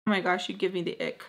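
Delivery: ordinary delivery, nothing marked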